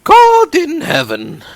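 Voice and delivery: high, loud, slow gasp of shock; shocked exclamation. Acted. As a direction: cry out to heaven in shock. Shocked reaction to murder news.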